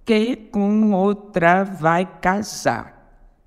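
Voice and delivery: very slow, syllable by syllable; contando sílabas. Shown as a demonstration. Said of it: "conte comigo". (escandindo devagar, sílaba por sílaba)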